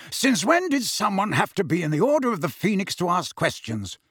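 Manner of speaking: challenging. Acted. Godfather challenges mother in argument.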